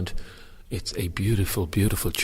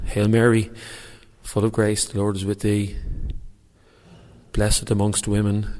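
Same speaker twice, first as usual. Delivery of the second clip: reciting a prayer aloud; 2 semitones higher, 3 dB louder than usual. Shown as a demonstration. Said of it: "this is for you, Count Dracula".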